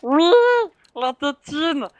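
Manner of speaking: voix aiguë et possessive de créature; Gollum. Pretend. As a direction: susurre comme une créature obsédée par son trésor. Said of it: "Ah, Gollum, Gollum, chut". (voix de Gollum)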